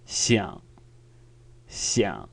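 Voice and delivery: "Xiang" soft, short and falling into a croak; gentle croaky low "Xiang", no rise; non-emphatic third tone. Shown as a demonstration. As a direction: let "Xiang" sink gently low in the throat. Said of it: "a softer sound".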